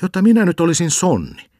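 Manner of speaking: put-on boy bristles at being called a bull; indignant retort. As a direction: bristle at the insult. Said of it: "tenäsi hän".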